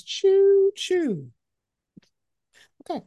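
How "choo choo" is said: high playful choo choo; train whistle. Retold or imitated. Fan teasing about the actor's looks, playful high whistle. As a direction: toot it like a flirty train whistle. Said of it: "all I thought was".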